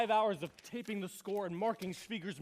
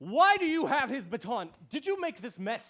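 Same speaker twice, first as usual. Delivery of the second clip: indignant demand; 3 semitones higher, 8 dB louder than usual. Acted. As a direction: confront the intruder, voice jumping up. Conductor confronts an intruder.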